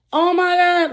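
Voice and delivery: excited. Retold or imitated. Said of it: "she getting kinda hype".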